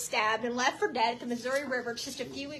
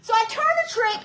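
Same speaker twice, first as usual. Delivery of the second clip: ordinary talk, nothing marked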